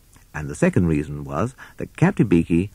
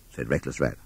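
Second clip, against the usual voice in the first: usual speaking voice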